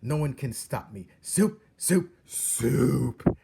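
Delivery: high, very loud, defiant repeated soup; defiant shout. A narrator's reading.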